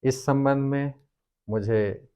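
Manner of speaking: normal talking voice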